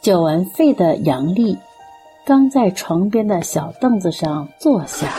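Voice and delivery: ordinary delivery, nothing marked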